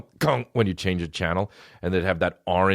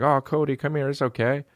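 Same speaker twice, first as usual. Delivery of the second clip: soothing dog; 8 semitones higher than usual. Retold or imitated. Comforting the crying dog.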